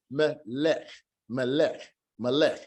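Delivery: melech as me-lech, final shiwa silent; teacher repeating melech. Shown as a demonstration. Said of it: "closed syllable because this shiwa is not gonna be pronounced".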